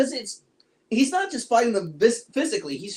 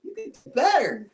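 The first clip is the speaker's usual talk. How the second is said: higher, teasing disappointment; exasperated; 8 semitones higher, slower than usual. Retold or imitated. To the filmmakers, higher mock exasperation. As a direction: come on, nudge them to do better. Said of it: "And it was just like".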